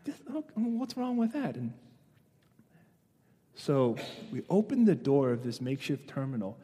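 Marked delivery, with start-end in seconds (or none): 0.0-1.6 soft, higher-pitched questioning; puzzled protest. Acted. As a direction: ask it quietly, high and puzzled. Acted team's puzzled reply.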